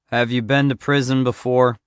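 ordinary delivery

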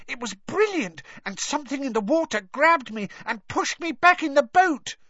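put-on high-pitched excited boy; shrill excitement. A narrator's reading. Boy telling his brother about his adventure, shrill. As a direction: gush it in a shrill little voice. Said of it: "he said shrilly".

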